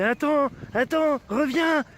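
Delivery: high loud desperate call. Acted. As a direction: call after someone leaving. Calling someone back.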